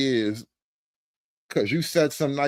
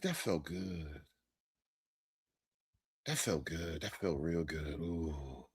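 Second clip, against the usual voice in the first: very soft, blissed-out murmur; mock-overwhelmed, hushed satisfaction; hushed savouring; 12 semitones lower, 11 dB quieter than usual. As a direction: barely voice it, as if savouring deep relief. Savours hearing the apology.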